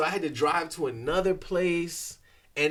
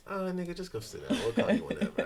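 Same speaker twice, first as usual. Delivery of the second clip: quick, softer, dismissive mumble; careless brush-off; 4 semitones higher, 5 dB quieter, faster than usual. Retold or imitated. Staff telling a patient to go sit down, careless and dismissive. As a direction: wave the patient away without caring. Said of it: "If you just be like".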